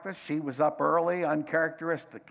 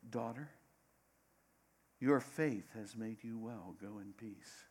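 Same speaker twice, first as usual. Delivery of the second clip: tender blessing; 8 dB quieter than usual. Retold or imitated. Jesus speaking tenderly to the healed woman, soft. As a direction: bless her gently, fading out.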